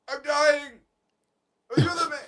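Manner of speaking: over-the-top fake dying plea; mock dying. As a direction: ham it up like a deathbed scene. Retelling dramatic near-death moment.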